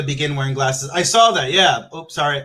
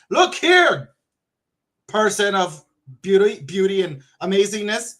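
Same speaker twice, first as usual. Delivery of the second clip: high, loud mock protest; mock indignant; 5 semitones higher, 4 dB louder than usual. Streamer protesting to a viewer, mock indignant. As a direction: burst out with sudden playful indignation.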